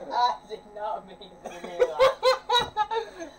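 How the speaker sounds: slow, giggling apology; laughing apology. Real, not acted.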